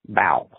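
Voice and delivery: "Bow" with a rounded vowel and no L; funky bow. Shown as a demonstration. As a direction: drop the L, say it bow. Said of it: "pronounces it literally as bow".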